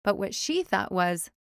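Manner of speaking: plain reading voice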